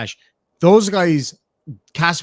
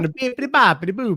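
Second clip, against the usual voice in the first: lightly pitched-up mock cartoon dad babble; a hint of Peter Griffin, higher and a bit louder; Peter Griffin imitation; 7 semitones higher, 3 dB louder than usual. Streamer echoing a dog clip, a hint of a cartoon dad. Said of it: "it's like fucking Peter Griffin".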